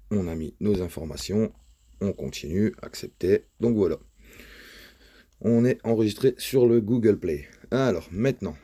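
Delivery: usual speaking voice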